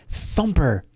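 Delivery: hint of a friendly adventurer bargaining with a bunny; lightly voiced pleading hero. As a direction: gently ask the rabbit for a favour. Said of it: "Perrin is like".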